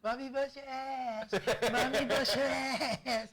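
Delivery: high, sing-song mockery of a kid brother; little brother's taunting laugh. Little brother mocking the sibling who got smacked, high taunting laugh. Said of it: "my brother started laughing".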